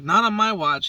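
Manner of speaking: high, loud heroic boast; defiant. Retold or imitated. Retelling saving the bird, loud and high. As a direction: proclaim it like a hero.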